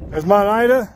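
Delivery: ordinary delivery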